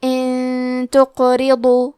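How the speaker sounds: ان تقرضوا میں نون کو غنہ سے ناک میں چھپا کر; آہستہ، نون پر اخفاء کا غنہ; اخفاء غنہ کے ساتھ. Shown as a demonstration. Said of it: "غنہ کے ساتھ ادا کریں گے". (نون ساکن کا اخفاء غنہ کے ساتھ پڑھیں)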